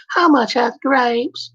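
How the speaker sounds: a cartoon voice put on for an everyday line; cartoon character voice. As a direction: squeak it out like a cartoon character asking the price of grapes. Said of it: "there was a guy doing cartoon voices".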